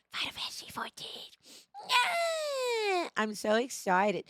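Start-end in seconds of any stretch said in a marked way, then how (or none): 0.1-1.3 breathy whisper. Real, not acted. Hyped game announcement. 1.9-3.1 high excited squeal. Real, not acted. Excited cheer for game.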